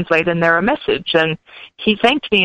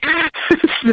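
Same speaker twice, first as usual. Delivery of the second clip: high burst of laughter at the joke; real laugh; 10 semitones higher than usual. Real, not acted. Laughing at the other-side joke.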